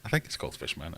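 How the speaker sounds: normal talking voice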